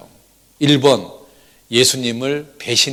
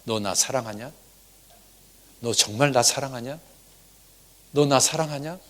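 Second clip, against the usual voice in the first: soft, hushed questions; Jesus quietly asking Peter; gentle asking as Jesus; 8 dB quieter than usual. Acted. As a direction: ask tenderly, close to the ear. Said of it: "이렇게 물어봅니다".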